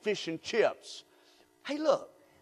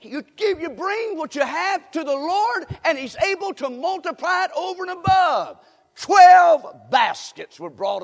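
building preaching shout; 10 semitones higher, 11 dB louder than usual. Acted. Preacher promising the church God multiplies, building shout. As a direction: build it to the climax.

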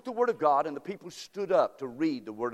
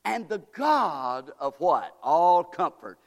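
preaching scripture; 3 semitones higher, 5 dB louder, slower than usual. Acted. Preacher reading to the congregation, loud slow fervour. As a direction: call it out with fervour. Reads verse three, preaching.

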